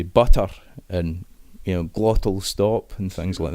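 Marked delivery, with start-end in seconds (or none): 0.0-0.5 "butter" with a clear, released T; trained-actor "butter", T crisply pronounced; enunciated T. Shown as a demonstration. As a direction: sound the T fully in the middle of "butter". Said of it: "actors get taught to say".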